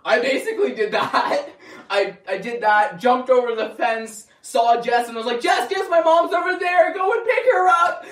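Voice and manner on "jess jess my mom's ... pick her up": much higher shout across the yard; calling out. Retold or imitated. Shouting to Jess while running to the pool, loud call. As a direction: yell to her from a distance.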